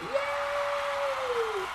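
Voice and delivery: very high joyful yay; high happy cheer. Real, not acted. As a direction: burst out in delight. Baby is born, cheering.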